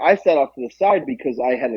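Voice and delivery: ordinary talk, nothing marked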